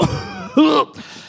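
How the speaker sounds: high strained cough; mock winded cough. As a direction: cough like you've been hit. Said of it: "breath knocked out of me".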